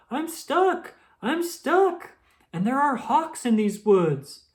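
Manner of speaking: high, loud frantic pleading; panic, trapped and scared of hawks; panicked rabbit. A narrator's reading. Trapped rabbit begs the squirrel for help, high frantic panic.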